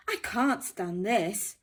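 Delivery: loud, exasperated complaint; fed-up Mister Bear. Acted. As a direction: snap it out, fed up with the noise.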